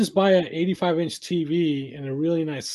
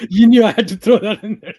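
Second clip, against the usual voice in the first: laughing; 5 semitones higher, 8 dB louder than usual. Real, not acted. Laughing at his own joke.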